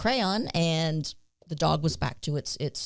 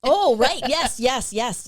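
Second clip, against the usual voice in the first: surprised recall; 7 semitones higher, 5 dB louder, slower than usual. Real, not acted. Sudden recall of the story.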